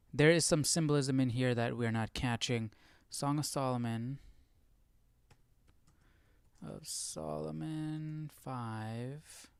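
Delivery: ordinary talk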